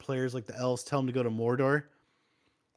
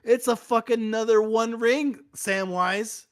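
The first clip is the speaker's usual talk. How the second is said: higher and louder, rising at the end; excited exclaim; 8 semitones higher, 5 dB louder than usual. Retold or imitated. Frodo tells Samwise about the new ring, high and excited. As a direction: burst out with the news. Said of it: "It's like".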